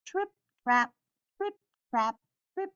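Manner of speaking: ordinary delivery, nothing marked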